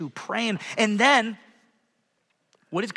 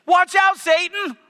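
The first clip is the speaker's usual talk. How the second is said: triumphant taunt; 11 semitones higher, 7 dB louder than usual. Real, not acted.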